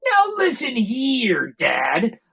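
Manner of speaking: loud, high defiant rant; loud furious Onceler tirade; yelling. Retold or imitated. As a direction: shout down the nagging Lorax. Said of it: "I yelled at the Lorax".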